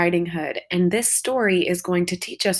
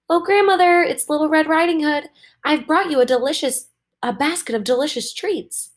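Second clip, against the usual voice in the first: put-on little girl calling out; 9 semitones higher, 4 dB louder than usual. Little Red calls at the door.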